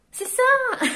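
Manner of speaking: gleeful high-pitched cry; delighted squeal. Real, not acted. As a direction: squeal it with joy. Delighted reaction to own reading.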